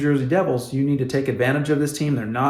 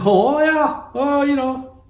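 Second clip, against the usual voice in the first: mock careless player; 12 semitones higher, 3 dB louder, slower than usual. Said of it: "look yourself in the bloody mirror and go".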